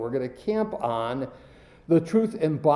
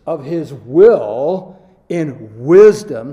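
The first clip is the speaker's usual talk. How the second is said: much louder, slow, wisdom held; stress on wisdom; 12 dB louder, much slower than usual. Shown as a demonstration. Preacher driving home the word wisdom. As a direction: pound out wisdom slowly. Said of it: "His emphasis on the word".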